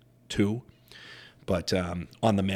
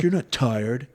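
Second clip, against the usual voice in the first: higher, louder mock coach rebuke; imitating insistent coach; 5 semitones higher, 7 dB louder, slower than usual. Coach to gassed fighter mid-tournament, insistent. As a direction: imitate a cornerman firing up his fighter. Acted corner coach urging him on.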